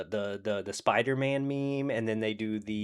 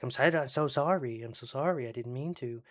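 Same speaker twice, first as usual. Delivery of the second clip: repeated sheepish apology; 3 semitones higher than usual. Retold or imitated. Retelling him made to say it again, mock pleading. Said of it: "Say it again".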